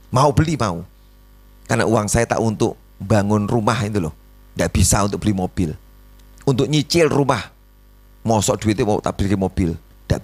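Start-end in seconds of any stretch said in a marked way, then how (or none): none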